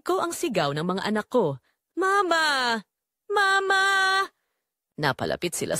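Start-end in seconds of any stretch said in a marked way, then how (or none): 1.9-3.8 high frantic cry of Mama; children crying out. Acted. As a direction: scream for mother in panic. Children screaming for fallen mother.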